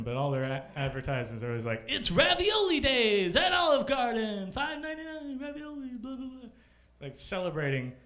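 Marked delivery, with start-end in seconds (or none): 1.9-6.5 mock ad voice. Comic imitating an Olive Garden TV ad, hammed up. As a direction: sell it like a cheesy commercial. Mocking ad announcer.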